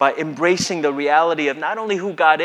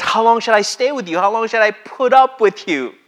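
exasperated; 6 semitones higher, 6 dB louder than usual. Retold or imitated. Quoting Jesus scolding his disciples.

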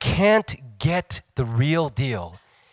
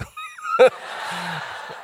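laugh. Real, not acted.

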